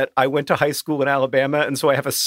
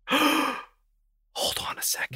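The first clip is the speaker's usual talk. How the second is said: stunned whisper; 11 semitones higher, 8 dB quieter, much slower than usual. Real, not acted. Stunned by the news.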